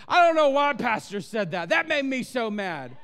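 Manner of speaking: loud, whiny grumbling about pastor; mock offended churchgoer. Pastor mimicking an offended congregant, loud mock grumble. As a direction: play a sulking church member, loud. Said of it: "Pick on Pastor Grace".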